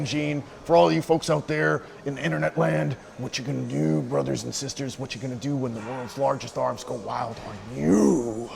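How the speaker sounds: big pro wrestler promo voice; wrestling promo. Pretend. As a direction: hype up the crowd like a wrestler. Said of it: "we're cutting a promo".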